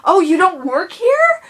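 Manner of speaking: high, loud, slow, whiny mock apology; mocking the customer. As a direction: parody a flustered lady gushing sorry. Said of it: "And she's like".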